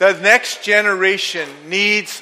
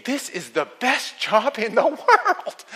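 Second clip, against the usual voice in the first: thinking aloud; 4 semitones higher, 4 dB quieter, slower than usual. Acted. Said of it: "And he's thinking".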